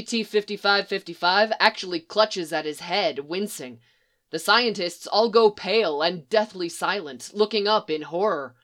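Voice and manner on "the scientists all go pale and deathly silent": ordinary delivery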